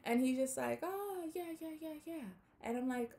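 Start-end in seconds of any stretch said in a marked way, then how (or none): 0.8-2.3 a higher, quieter mock voice of the man agreeing; imitating the man's eager yes. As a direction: lightly voice the man nodding along, softer and higher.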